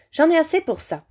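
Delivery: high, insistent villain; high-pitched voice. A narrator's reading. Hidden master overrules his worried servant, high-pitched. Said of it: "La voix aiguë s'éleva à nouveau".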